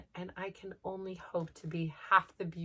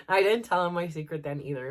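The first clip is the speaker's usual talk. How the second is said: ordinary talk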